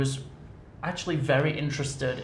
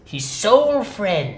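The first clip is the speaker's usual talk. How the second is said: put-on slow, loud and higher old miser; Scrooge; 8 semitones higher, 11 dB louder, slower than usual. Scrooge voiced by [name].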